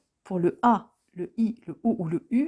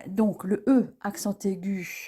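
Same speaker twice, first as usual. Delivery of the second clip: usual speaking voice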